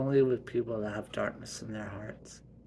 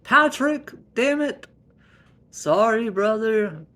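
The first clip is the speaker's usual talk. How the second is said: very high, loud yell; shouting; 11 semitones higher, 13 dB louder than usual. Acted. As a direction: yell from the other room. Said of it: "he shouted from the other room".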